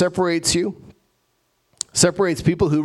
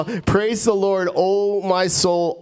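slow, higher-pitched exhortation to oneself; urging own soul; 4 semitones higher, much slower than usual. Acted. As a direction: rouse your soul with drawn-out, lifted words. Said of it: "like you're talking to your soul".

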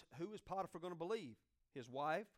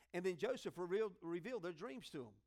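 usual speaking voice

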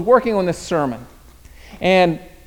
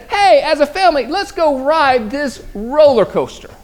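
dad's invitation; 7 semitones higher than usual. Retold or imitated. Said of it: "And my dad says".

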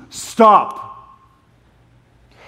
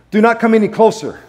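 raised, commanding warning; mild mock yell of God; 7 semitones higher, 3 dB louder, faster than usual. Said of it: "God is yelling at Moses".

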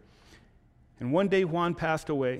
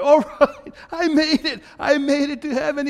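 much higher and louder, laughing with joy; excited, laughing; 12 semitones higher, 9 dB louder than usual. Acted. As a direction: burst in laughing, thrilled to be in. Juan thrilled entering heaven.

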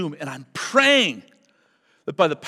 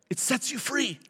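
quiet; 6 semitones higher, 8 dB quieter than usual. Shown as a demonstration.

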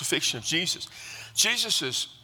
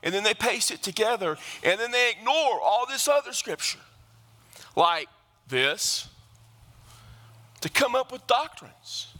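high, driving preacher's cadence at full force; fiery preaching; 7 semitones higher than usual. Acted. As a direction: preach it hard, rising over the congregation.

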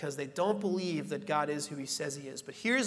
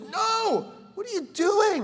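much higher, alarmed Jesus voice; a high startled rebuke; mock rebuke; 5 dB louder, slower than usual. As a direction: stop him in alarm.

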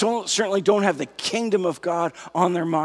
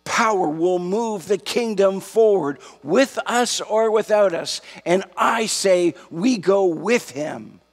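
raised, emphatic pulpit voice; fervent sermon delivery, pitched up and punchy; impassioned preaching; 4 semitones higher, 4 dB louder than usual. Real, not acted. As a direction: preach it with mounting conviction, stretching key words. Preacher builds to full-force exhortation.

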